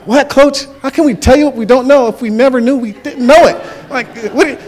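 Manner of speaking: high, loud comic bafflement; exasperated players. Retold or imitated. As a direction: protest to the coach in mock confusion. Baffled players reply to coach.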